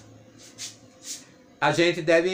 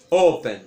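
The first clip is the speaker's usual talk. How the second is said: "Open" with a loud O that falls away; first-syllable stress; 6 dB louder than usual. Shown as a demonstration. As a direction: hit the O of "Open", let -pen drop. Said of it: "a sílaba tônica não é a última".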